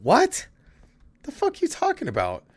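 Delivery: high-pitched incredulous comeback; retold disbelief. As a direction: voice shoots way up in disbelief. Retold disbelieving reply to teacher.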